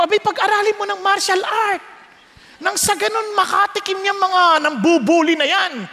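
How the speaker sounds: preacher retelling his own brash advice to his sister, rising; pushy advice. Retold or imitated. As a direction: insist like a fired-up older brother. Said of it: "Sabi ko".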